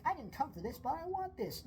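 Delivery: high-pitched eager shopper; hammed-up wanting, pitch climbing; mock impulse-buyer excitement. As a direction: play someone suddenly tempted by a snack. Acting imagined impulse shopper.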